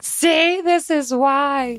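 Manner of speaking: high, slow, smug I-told-you-so; smug chicken owner. Retold or imitated. Chicken raisers gloating about egg prices in a mock smug voice. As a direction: gloat like a backyard chicken keeper. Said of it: "people who have been raising chickens are like".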